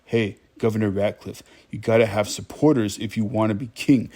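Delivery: usual speaking voice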